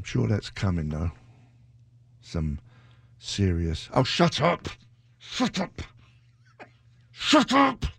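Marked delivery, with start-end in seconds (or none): 3.9-7.9 high falsetto squawk of a telling-off; mock scolding. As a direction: squeal it to silence your own gloomy thoughts. Cuts off his own gloomy talk.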